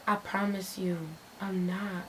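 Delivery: soft, low retold assurance; hushed reassurance; placating. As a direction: answer him quietly and evenly. Placating the angry caller, retold.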